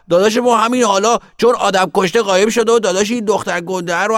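put-on excited little boy telling tales. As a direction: rattle out the fight like a worked-up kid.

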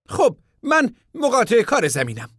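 a high voice for the stranger; high contractor voice. A narrator's reading. Contractor asking the camp men about work, high voice.

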